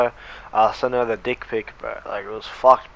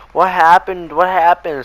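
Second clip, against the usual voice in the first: mock begging; 6 semitones higher, 5 dB louder than usual. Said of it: "kept begging her".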